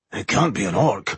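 ordinary delivery, nothing marked

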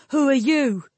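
high, sharp shouted question; mild narrated shout; shouted challenge. A narrator's reading. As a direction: call it out high, demanding an answer. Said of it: "Perrin shouted".

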